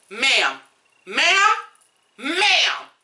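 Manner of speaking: rising exasperated "ma'am, ma'am". Real, not acted. Exasperated scolding of the rapper.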